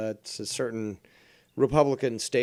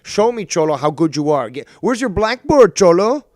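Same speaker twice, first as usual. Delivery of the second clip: building from a sharp retort into a loud, much higher mocking yell; mock indignant rant; 4 semitones higher, 8 dB louder than usual. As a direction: scoff at the know-it-all, getting louder and higher. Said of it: "I'd be like".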